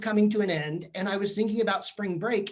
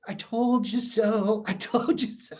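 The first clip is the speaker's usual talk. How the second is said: smug gloating; 2 semitones higher, slower than usual. Retold or imitated. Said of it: "I said to her".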